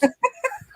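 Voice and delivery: short high giggle. Real, not acted. High giggle after taunting the troll.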